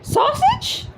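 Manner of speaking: shrill, very high screech. A narrator's reading. Wife screeching at her husband's offer of sausage. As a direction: shriek the word in outrage. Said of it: "she screeched".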